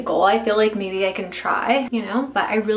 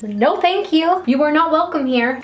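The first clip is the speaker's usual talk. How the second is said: mock scolding; 6 semitones higher, 4 dB louder than usual. Scolding her gray hairs.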